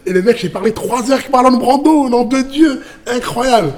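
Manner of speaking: high-pitched, marvelling exclamation; amazed. Retold or imitated. Teller marvelling at three hours with Marlon Brando, amazed. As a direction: relive the sheer disbelief.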